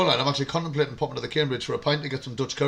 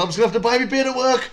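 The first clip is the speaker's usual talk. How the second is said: higher, louder panicked self-quote; frantic rushing; 10 semitones higher, 4 dB louder than usual. Retold or imitated. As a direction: blurt it while dashing down the stairs. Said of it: "I went".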